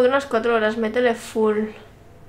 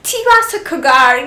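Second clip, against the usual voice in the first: put-on Spanish in a louder, higher English accent; imitated English accent; 4 semitones higher, 7 dB louder than usual. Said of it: "lo dice con ac-, con acento".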